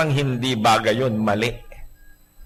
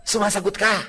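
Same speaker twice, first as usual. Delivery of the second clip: ordinary talk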